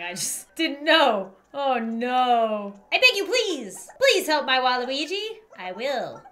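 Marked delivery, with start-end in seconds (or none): 2.9-5.4 pleading, raised character voice; Kirby the mayor. Acted. Mayor begging for help for Waluigi, pleading voice. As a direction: beg sweetly as the worried mayor.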